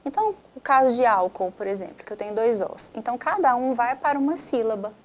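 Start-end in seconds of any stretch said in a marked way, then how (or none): none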